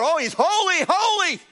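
high, ecstatic cry; angels crying out. Retold or imitated. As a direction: cry it out in awe. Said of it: "They cried out".

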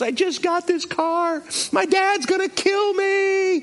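high-pitched mock wail; imitated crying. Girl after wrecking her new car, mock crying. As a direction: wail it like an upset girl, drawn out. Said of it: "And she's crying".

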